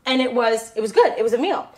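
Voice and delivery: ordinary talk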